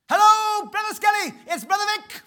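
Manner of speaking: very high, loud, bursting with excitement; excitable Brother Vic. Retold or imitated. Imitating Brother Vic on the phone, high and loud. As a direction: boom it out like the most excitable guy alive. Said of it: "the most excitable guy I ever met".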